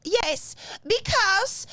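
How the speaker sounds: put-on high-pitched Dutch accent. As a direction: imitate a Dutch comedian character. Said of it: "doing this Dutch accent".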